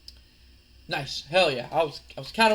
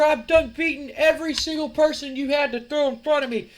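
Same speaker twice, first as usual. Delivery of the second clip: high, loud wrestling promo rant; fed-up rant; 9 semitones higher, 6 dB louder than usual. Acted. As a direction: loudly call out your rival, worked up. Acted wrestler confronting his rival.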